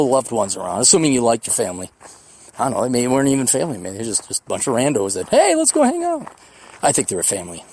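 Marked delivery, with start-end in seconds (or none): 5.3-6.3 high-pitched mock invitation; lightly put-on high voice; chirpy invite. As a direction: imitate an eager pal, pitched way up. Voicing randos making a casual invitation.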